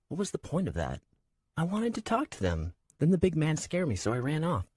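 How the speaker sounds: put-on high, innocent young woman; childlike girl voice. A narrator's reading. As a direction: speak like a naive girl. Shara's childlike voice.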